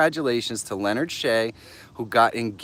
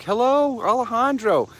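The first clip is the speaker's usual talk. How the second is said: high, louder hello; excited greeting; 10 semitones higher, 4 dB louder than usual. Real, not acted. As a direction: welcome him warmly and loudly. Greeting a late viewer.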